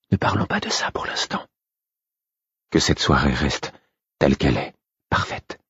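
à voix basse, presque soufflé; un secret entre amants, tout bas; chuchoté. A narrator's reading. Molly le fait taire doucement. (chuchotement tendre)

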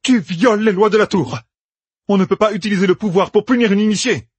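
high-pitched, indignant protest; outraged shout. A narrator's reading. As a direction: cry out the accusation at the usurper. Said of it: "cria Ferran". (protestation criée, indignée)

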